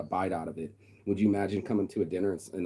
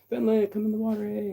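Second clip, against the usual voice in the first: calling out; 4 dB louder than usual. Retold or imitated. Calling girl over in the water.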